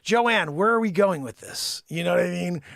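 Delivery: usual speaking voice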